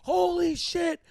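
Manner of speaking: high, loud stunned exclamation; retold shock. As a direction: exclaim in horror at the sight. His retold reaction to gore.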